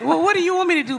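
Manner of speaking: ordinary talk, nothing marked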